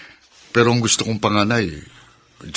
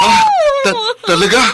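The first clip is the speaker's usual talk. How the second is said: stunned joy; 7 dB louder than usual. Acted. Husband hears she is pregnant.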